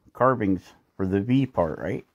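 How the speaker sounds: usual speaking voice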